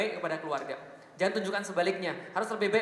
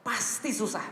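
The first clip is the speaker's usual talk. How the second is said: ordinary delivery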